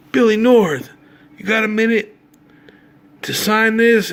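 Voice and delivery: high, loud call across the stands; yelling out. Retold or imitated. Buddy calling across the ballpark to the player, loud and high. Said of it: "my buddy yelled out".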